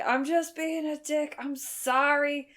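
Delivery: high, slow, stretched-out call; mock distant shouting. Imitating Murderface confessing from afar, high drawn-out call. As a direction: call it out slowly, as if across a theatre. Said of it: "They're all shouting from various locations".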